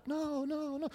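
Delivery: put-on boy answering his mother's question; sheepish kid. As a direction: shake your head like a little boy. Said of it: "do you wanna feel bad".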